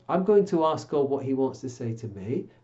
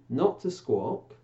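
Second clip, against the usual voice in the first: ordinary delivery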